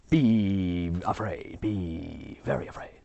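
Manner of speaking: mock spooky.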